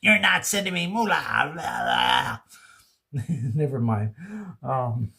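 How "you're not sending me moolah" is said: slow put-on complaining voice; mock whiny viewer. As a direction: whine like a stingy fan.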